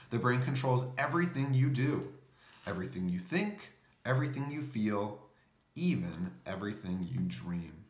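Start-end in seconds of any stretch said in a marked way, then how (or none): none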